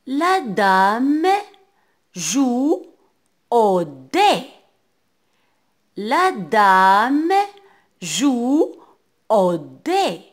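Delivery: loud, slow word-group reading with pauses; projected teacher's dictation voice; model rereading. Shown as a demonstration. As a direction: read it out clearly for beginners, in separate chunks. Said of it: "Je relis".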